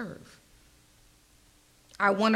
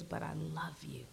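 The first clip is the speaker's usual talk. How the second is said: softer, lower unvoiced whisper; whispered tenderly; 4 semitones lower, 10 dB quieter than usual. Preacher voicing God's loving words, whispered. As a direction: breathe it like a secret.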